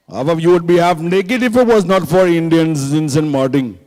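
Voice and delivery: hammed-up Indian accent, indignant lecture. Indian shopkeeper telling the crowd to be grateful, Indian accent. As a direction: put on a thick Indian accent. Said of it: "That's why the Indian name".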